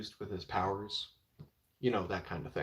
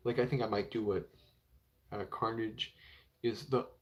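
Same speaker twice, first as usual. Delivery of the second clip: ordinary delivery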